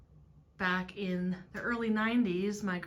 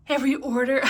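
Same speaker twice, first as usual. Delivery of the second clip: much louder and higher, a bubbly sales pitch; over-excited; 6 semitones higher, 10 dB louder, faster than usual. Pretend. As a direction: gush the words like an overeager host. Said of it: "I don't talk like this in real life".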